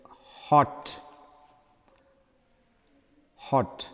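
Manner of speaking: hot with a brief, open o; short-vowel "hot", repeated after a pause. Shown as a demonstration. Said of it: "a short vowels O".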